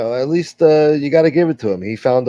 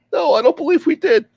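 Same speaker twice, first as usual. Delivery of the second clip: much higher comic voice, cocky and accusing; rival character voice. Acted. Rival bursting in to call the tough guy a liar, much higher voice. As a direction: squeeze it up high and brag. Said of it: "here he is right now".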